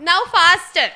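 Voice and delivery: 大声催促; 提高嗓门喊快一点; loud urging. Real, not acted. Facilitator urges the group on.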